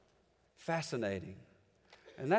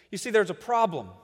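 normal talking voice

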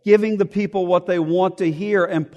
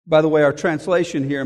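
ordinary delivery

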